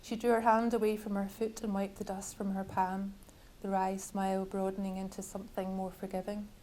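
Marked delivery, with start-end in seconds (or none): none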